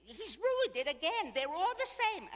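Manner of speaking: very high, loud, drawn-out complaint; outraged moaning.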